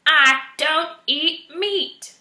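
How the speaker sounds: high, loud, slow, each word hammered; furious slow shout. A narrator's reading. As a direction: drive each word home in anger. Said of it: "Erith screamed".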